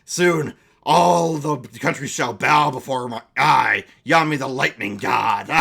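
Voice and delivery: loud, grand villain proclamation; gloating villain. Acted. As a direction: boast to the whole country. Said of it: "Yami's like".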